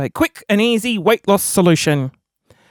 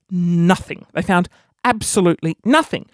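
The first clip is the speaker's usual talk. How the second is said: ordinary talk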